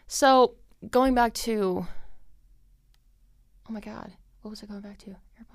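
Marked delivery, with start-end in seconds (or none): 3.7-5.5 much softer whispered aside, muttered to herself; trying to remember what she was saying, whispering. Real, not acted. Losing her train of thought.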